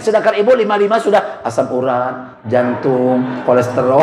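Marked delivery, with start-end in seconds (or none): none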